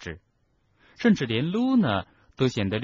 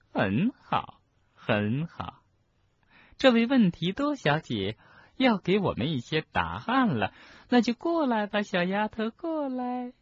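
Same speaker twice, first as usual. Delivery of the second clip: gloating professor; 12 semitones higher than usual. Acted. Professor calling a tearful pupil over, gloating. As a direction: summon the girl with lilting condescension. Umbridge summons Hermione.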